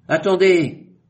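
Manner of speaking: sharp, high, loud cry; exclaiming wait. A narrator's reading. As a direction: stop everyone short with one word. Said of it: "s'exclama Chinchar".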